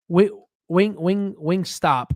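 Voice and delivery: very slow, careful, syllable by syllable; slow tongue twister. Real, not acted. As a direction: spell the name out slowly like a tongue twister. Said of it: "Say that five times".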